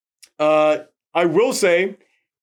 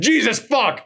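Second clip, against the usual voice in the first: higher, exasperated mock outburst; breaking into swearing; 7 semitones higher than usual. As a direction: act out finally snapping. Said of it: "she just broke and went".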